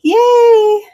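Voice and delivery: high, loud happy squeal; excited yay. Real, not acted. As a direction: cheer the raiders in. Celebrating the raid.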